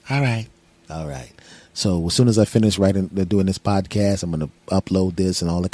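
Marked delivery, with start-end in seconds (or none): 0.9-1.3 much lower, softer second voice; low, quiet reply to himself; different voice. Acted. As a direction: agree in a deep, hushed alter-ego voice. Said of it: "using a different voice".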